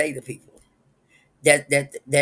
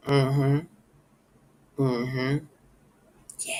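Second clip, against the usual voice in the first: calm dismissive mm-hmm; much slower than usual. Shown as a demonstration. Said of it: "and do like this".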